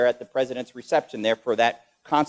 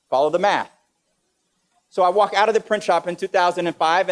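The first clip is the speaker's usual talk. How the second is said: ordinary talk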